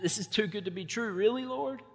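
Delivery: can't believe the good news; overjoyed Israelite reeling in disbelief at God; stunned disbelief. Retold or imitated. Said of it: "reeling a little bit in disbelief".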